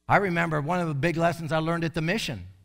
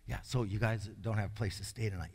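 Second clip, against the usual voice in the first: calm mentor voice; 9 semitones lower, 12 dB quieter, faster than usual. Shown as a demonstration. Older mentor greeting homeless visitors, soft and calm. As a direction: lower and much softer, easy and unhurried. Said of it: "Here's what you do".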